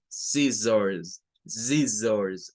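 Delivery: "scissors" with short i, schwa ending, long final z; pronunciation model. Shown as a demonstration. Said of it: "repite al final el mismo sonido".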